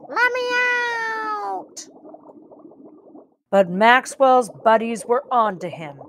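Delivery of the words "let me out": high, drawn-out plea to get out; trapped cry. Acted. Maxwell calls from inside Birdie's belly, a high trapped cry.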